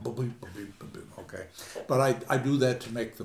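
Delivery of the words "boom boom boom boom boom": "boom, boom" as a low, soft, mechanical pulse; mock metronome beat. Shown as a demonstration. Lecturer admitting he exaggerated the meter, soft rhythmic booms. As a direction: toss off "boom, boom" quietly in strict rhythm. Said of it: "Shakespeare's poem is not really that".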